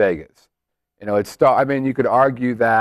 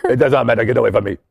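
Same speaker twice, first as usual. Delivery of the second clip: put-on French accent; much faster than usual. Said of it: "That was my French accent".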